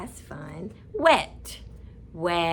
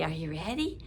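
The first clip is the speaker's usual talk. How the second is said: ordinary talk, nothing marked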